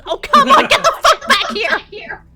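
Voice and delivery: hammed-up shout after someone walking off; angry shout. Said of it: "And he goes".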